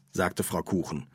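ordinary delivery, nothing marked

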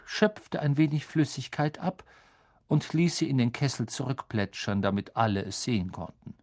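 usual speaking voice

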